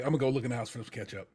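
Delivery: fast, soft excuse; quick mumble. Retold or imitated. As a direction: mumble it as you slip away. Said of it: "Carmi turns tail".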